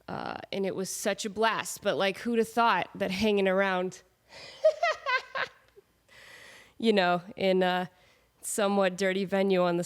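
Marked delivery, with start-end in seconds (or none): 4.6-5.5 laughing, high and sudden; high burst of laughter. Real, not acted. Vlogger laughing about catching a virus. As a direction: laugh at her own joke.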